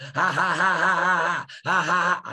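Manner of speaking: loud mocking devil laugh; Satan laughing at the doomed man, mocking; Satan's laugh. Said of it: "And Satan laughed".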